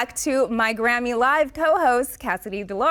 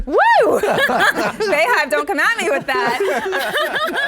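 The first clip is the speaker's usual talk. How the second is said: laughing; 9 semitones higher, 3 dB louder, slower than usual. Real, not acted. Teasing fans mid-laugh.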